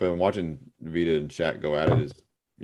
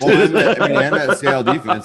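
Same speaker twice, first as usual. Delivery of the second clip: talking through laughter, louder and higher; laughing; 6 semitones higher, 7 dB louder than usual. Real, not acted. Co-host answers a jab at Geno Smith, laughing. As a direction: start the point while still cracking up.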